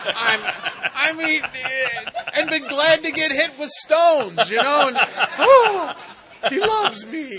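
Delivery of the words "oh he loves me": very high, loud, laughing mock swoon; laughing falsetto swoon over her new boyfriend; swooning squeal. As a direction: squeal it like a lovestruck teen. Mocking smitten girlfriend.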